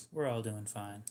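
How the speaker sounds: normal talking voice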